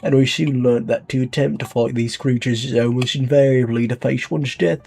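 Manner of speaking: speechify like a British leader on tape; British accent. Acted. Leader welcoming citizens to Un London, in a light British accent. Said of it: "I have to do the accent".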